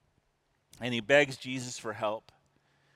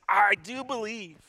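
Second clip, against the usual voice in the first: much higher, louder burst of conviction; crying out; 8 semitones higher, 5 dB louder than usual. Retold or imitated. Father cries out to Jesus as his faith sparks. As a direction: cry out with sudden, urgent faith. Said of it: "the father instantly cried out".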